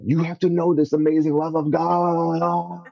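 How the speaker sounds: high, eager, fervent plea; retold excited preaching to a friend; fired-up urging. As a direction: burst with the news you just found.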